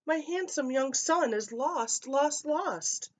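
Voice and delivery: high, wailing mother's plea; a mother crying out for her lost son; distraught mother. A narrator's reading. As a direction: plead like a frantic mother.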